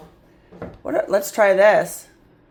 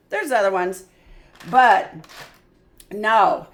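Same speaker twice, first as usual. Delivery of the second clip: usual speaking voice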